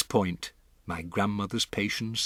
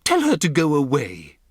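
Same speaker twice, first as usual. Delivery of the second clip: louder, higher, haughty brush-off; dismissive demand; 6 semitones higher, 7 dB louder than usual. Acted. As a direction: wave the old woman away with contempt. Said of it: "Missus Jenkins said".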